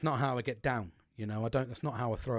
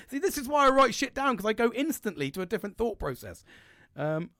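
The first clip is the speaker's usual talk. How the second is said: normal talking voice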